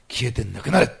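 loud command hurled at the sickness; forceful rebuke. Real, not acted. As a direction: drive the pain out with a shout. Rebuking pain in prayer. (病に向かって叫ぶ命令)

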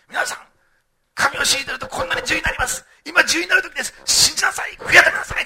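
loud, high, hammed-up preacher shouting; a pretend preacher yelling at the prisoners; mock street preacher. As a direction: bellow the call to repent like a zealot. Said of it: "って言ってね".

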